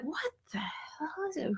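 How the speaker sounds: high, breath-only whisper of disbelief; bewildered whisper. Retold or imitated. Student baffled by mystery writing, whispering.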